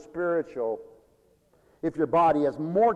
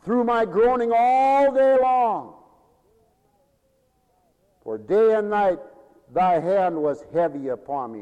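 slow, raised scripture reading; psalm reading; 4 dB louder than usual. Acted. Preacher reading the psalm to the congregation, solemn. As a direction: read the psalm with weight.

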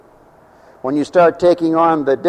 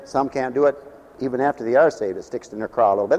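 usual speaking voice

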